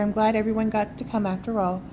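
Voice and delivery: plain reading voice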